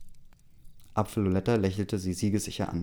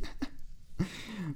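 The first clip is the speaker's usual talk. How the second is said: breathy laugh; 11 semitones higher, 11 dB quieter than usual. Real, not acted. Laughing at the own joke.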